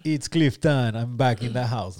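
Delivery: put-on playful Caribbean accent, reggae hype man. Showing off accents for groaning co-hosts, mock Caribbean accent. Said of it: "Bring out some accents".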